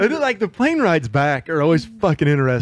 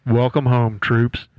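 pilot on the intercom; 4 semitones lower than usual. Retold or imitated. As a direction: announce it like an airline captain over the PA. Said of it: "he comes across the intercom".

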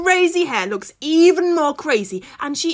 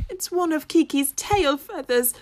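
ordinary delivery, nothing marked

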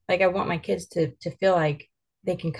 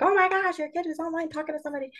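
much higher, fast, scandalized gasp; mocking negative Nancy; 10 semitones higher, faster than usual. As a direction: mimic a pearl-clutching busybody, high and rushed. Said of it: "go all negative Nancy on us and be like".

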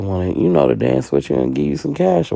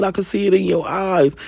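high, louder pleading accusation; whiny homeboy; 4 dB louder than usual. Retold or imitated. Homeboy accusing Pooh of sleeping with his friend, high whiny pleading. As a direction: whine it up high like a hurt friend.

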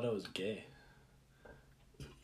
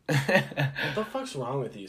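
raised, higher, drawn-out objection; slow, loud indignation; loud protest; 5 semitones higher, 11 dB louder, slower than usual. Real, not acted. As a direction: call your friend out, loud and slow. Caught out, protests friend's claim about him.

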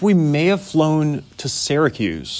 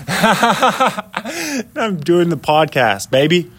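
laughing; 4 semitones higher, much slower than usual. Real, not acted. Laughing about the cold.

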